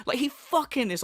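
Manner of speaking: usual speaking voice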